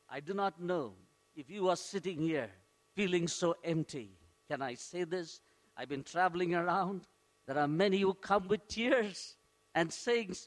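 soft intimate appeal. Acted. Pastor drops into intimate appeal.

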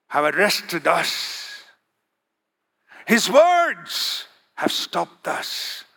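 slow, drawn-out words, sinking low at phrase ends; awestruck testimony. Retold or imitated. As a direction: give each phrase weight, as if overwhelmed. Awestruck officers explain their failure.

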